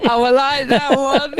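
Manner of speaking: slow words through real laughter; laughing approval. Real, not acted. Listener enjoying a friend's joke, laughing as they speak. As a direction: say it while still chuckling.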